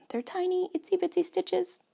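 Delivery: high, cooing voice for tiny stitches; cutesy high voice. Real, not acted. Knitter showing tiny stitches, high cooing voice. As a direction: coo over them.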